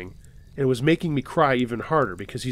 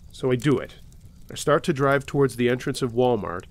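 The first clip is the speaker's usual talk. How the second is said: ordinary delivery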